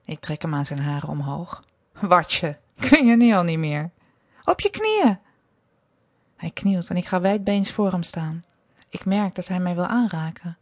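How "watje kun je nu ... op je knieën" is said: mock him, then sharply command; scornful command. A narrator's reading. Mistress mocks, then commands. (smalend, dan scherp bevelend)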